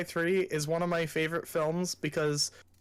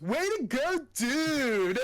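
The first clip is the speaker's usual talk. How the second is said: high excited cheer, pitched way up; goofy cheer; 9 semitones higher, slower than usual. Retold or imitated. Friend retelling a movie scene, high mock cheer. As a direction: squeal it like a giddy sidekick. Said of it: "the one guy goes".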